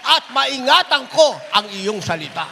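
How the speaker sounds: ordinary talk, nothing marked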